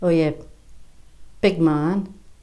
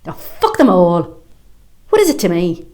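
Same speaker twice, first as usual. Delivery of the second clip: angry outburst; 6 dB louder than usual. Acted. Woman raging at the world, loud. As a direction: explode in frustration.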